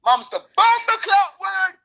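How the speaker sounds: high, drawn-out triumphant call; catchphrase sign-off. Real, not acted. Host signing off the podcast, exuberant slogan call. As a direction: belt out the slogan slowly and high.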